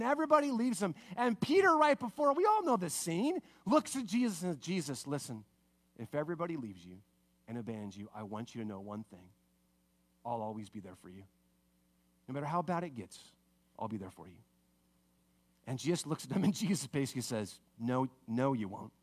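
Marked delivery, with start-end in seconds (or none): 4.6-14.4 low, hushed, earnest promise; quiet, heartfelt reassurance in a lower voice; solemn pledge. Acted. Peter pledges loyalty to Jesus. 17.8-18.9 low, soft knowing denial; understated, lower-pitched correction; gentle contradiction. Acted. Jesus gently contradicts Peter.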